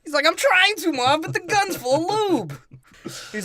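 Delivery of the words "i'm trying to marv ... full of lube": mock exasperated protest. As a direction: push it up high, stretching the end. Acted Harry protesting to Marv.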